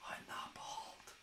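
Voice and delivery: whispered aside, breath only; slow whispered joke aside. Real, not acted. Denies being bald, aside.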